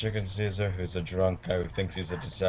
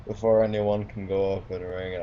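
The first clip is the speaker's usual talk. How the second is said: ordinary talk, nothing marked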